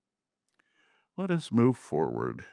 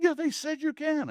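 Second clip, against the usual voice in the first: very high-pitched mock protest; squealed retort, imitating the woman; imitated screaming woman. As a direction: mimic her insisting, pitched way up. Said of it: "she screamed at me".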